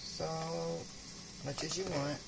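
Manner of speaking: ordinary talk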